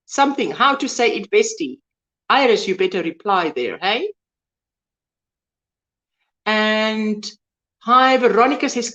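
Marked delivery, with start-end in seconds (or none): none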